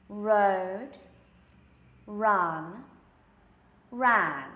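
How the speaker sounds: "Road", "run", "ran" with the tongue curled back; clear R words. Shown as a demonstration. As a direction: round the lips before each R. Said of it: "let's do a few more R sounds".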